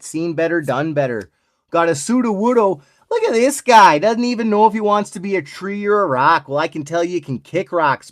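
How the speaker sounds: hammed-up Canadian accent, lively; playful put-on Canadian accent. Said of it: "Let's do this one Canadian style".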